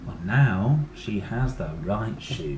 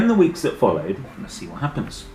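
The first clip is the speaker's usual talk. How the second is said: plain reading voice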